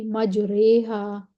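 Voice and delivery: slow, drawn-out मजरेहा with a long "e" after the r; imala. Shown as a demonstration. Teacher repeats the word with the big ye, slow imala. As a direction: stretch मजरेहा, "re" bent between a and i. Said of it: "बड़ी ये लगा देंगे".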